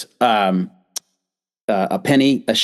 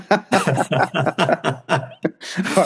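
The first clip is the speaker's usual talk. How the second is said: laughing; 5 semitones higher than usual. Real, not acted. Host reacts to joke.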